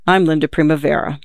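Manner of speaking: ordinary delivery, nothing marked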